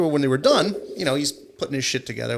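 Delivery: normal talking voice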